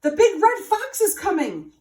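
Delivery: high, loud alarm; urgent warning. A narrator's reading. Jay warns the fox is coming, loud and high. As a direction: cry out that danger is near. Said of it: "called the jay".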